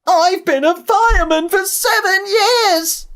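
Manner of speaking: loud, very high holler; a piercing cry for help; panicked yell. A narrator's reading. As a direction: yell it in high-pitched alarm. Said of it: "and said to Herbert".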